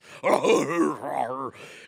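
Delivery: loud, growly monster-like guffaw; exaggerated mock guffaw; mimicked weird laugh. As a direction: imitate a bizarre Cookie Monster laugh. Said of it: "Who the fuck laughs like this".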